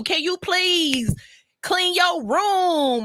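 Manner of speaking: very slow, stretched-out TikTok delivery. Retold or imitated. Mom to kids as a living TikTok, very slow. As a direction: draw every word out like a video. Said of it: "let me become a living TikTok".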